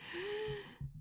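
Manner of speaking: soft drawn-out gasp; audible soft intake of breath. Real, not acted. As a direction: gasp quietly, drawing it out. Loud intake of breath alone.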